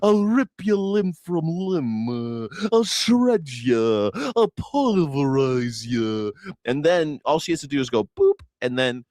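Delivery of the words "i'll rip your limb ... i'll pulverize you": slow, hammed-up blustering threats; mock tough lion. Cowardly lion boasting, slow mock bravado. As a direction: growl it like a fake tough guy. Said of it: "at first he's like".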